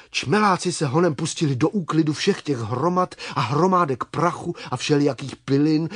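ordinary delivery, nothing marked

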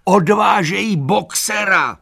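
very high, loud cry of alarm; alarmed shout. A narrator's reading. Donkey warns animals that Boxer is taken, alarmed shouting. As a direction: shout the alarm at full pitch. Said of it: "křičel".

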